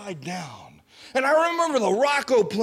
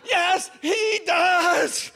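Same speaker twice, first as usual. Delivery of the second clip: desperate shout; 8 semitones higher, slower than usual. Retold or imitated. Teen shouting back to the singing missionary, high and slow. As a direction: yell it back, begging for mercy. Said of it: "And I said".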